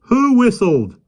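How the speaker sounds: high, loud call from afar. A narrator's reading. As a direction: call out from the gate. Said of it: "called out a voice".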